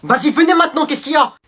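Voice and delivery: voix forte et provocante; loud taunting call. Acted. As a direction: crier pour provoquer. Sketch, calling out provocatively. (provocation criée)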